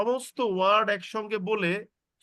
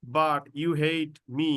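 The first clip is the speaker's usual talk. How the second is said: slow, deliberate phrase; 4 semitones lower, much slower than usual. Shown as a demonstration. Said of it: "কমা দিয়ে বললাম".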